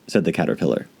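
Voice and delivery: ordinary delivery, nothing marked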